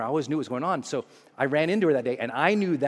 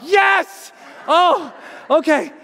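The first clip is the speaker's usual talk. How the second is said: much higher, louder "Yes"; thrilled; 13 semitones higher, 5 dB louder, much slower than usual. Retold or imitated. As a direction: cheer then catch your breath. Thrilled on hearing her voicemail.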